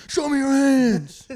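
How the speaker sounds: slow, high, loud police yell; cop shout. Acted. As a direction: bark orders as a cop. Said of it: "where I'm a cop".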